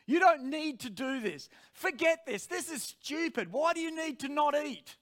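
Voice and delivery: high, mounting protest. Retold or imitated. Preacher's flesh argues against the fast, high and insistent. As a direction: argue insistently, pitched well up. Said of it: "this voice was getting louder and louder".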